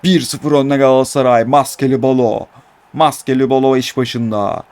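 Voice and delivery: play-by-play building to a loud goal call; live football commentary. Pretend.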